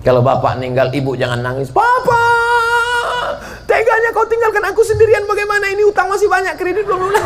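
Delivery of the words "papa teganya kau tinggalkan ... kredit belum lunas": mock wailing widow. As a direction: ham up a grieving wife's lament. Imitating wife wailing at deathbed. (tangisan istri yang dibuat-buat, tinggi dan keras)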